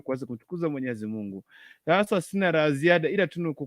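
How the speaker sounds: ordinary talk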